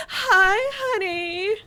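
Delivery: high, slow breathy tease; waifu voice. Retold or imitated. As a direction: coo it like an anime waifu. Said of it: "and then there was".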